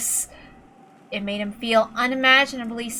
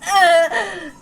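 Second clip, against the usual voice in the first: a touch higher, hurt disbelief; fading with the last breath; weak, disbelieving; 4 semitones higher than usual. Acted. Shot George murmurs in hurt disbelief, then groans.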